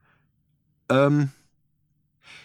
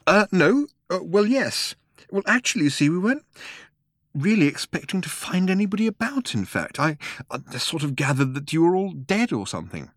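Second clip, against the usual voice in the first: put-on flustered young man; 10 semitones higher than usual. Arthur flustered, explaining himself.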